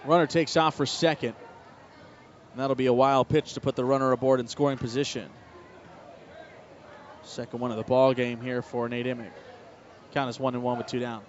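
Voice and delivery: steady baseball radio call; play-by-play. Acted. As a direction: broadcaster calling a wild pitch.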